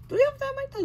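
high, loud disbelief; can't believe it's only three; astonished question. Pretend. Character astonished by news.

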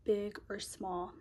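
soft, slow affirmation voice with long pauses; calm each line and let it settle; guided affirmations. Acted. Leads spoken affirmations softly.

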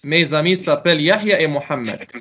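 ordinary talk, nothing marked

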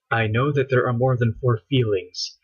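ordinary delivery

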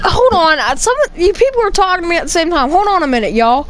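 exasperated streamer cutting everyone off; annoyed loud call. Real, not acted. Streamer swamped by several voices.

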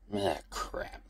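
soft muttered curse. Acted. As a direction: grumble it under your breath. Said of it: "Xander muttered".

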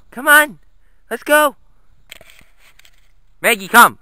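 usual speaking voice

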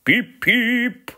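high, loud whistle-like call; engine whistle call. A narrator's reading. Little engine whistling a warning at the station, high and loud. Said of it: "we whistle".

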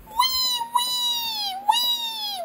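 very high wailed mock sob; squealed fake crying, like a tiny toy; pretend crying. Playing crying Bosco after "he go", squealed pretend wail. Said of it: "Bosco cry".

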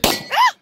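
high, sharp startled shriek; startled scream. Real, not acted. Woman at her first shot, startled scream. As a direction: jump at the gunshot with a yelp. Gun fired next to her.

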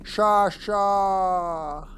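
long high call to another room. Real, not acted. Calling again, she didn't hear.

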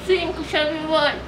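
cheeks stuffed full. Real, not acted. Five marshmallows stuffed in.

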